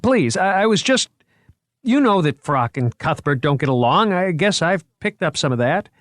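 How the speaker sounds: a curator anxiously backpedalling, high and loud; flustered curator. A narrator's reading. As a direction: scramble to take it back. Moriarty apologising, flustered.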